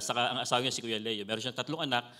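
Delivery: usual speaking voice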